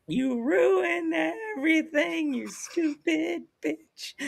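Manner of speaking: high, slow, crying wail, hammed-up; fake-blubber it like a wounded soap star; mock sobbing outburst. Narrator imagines crying at the ring announcer, hammed-up high sobbing. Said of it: "That's what I just felt like".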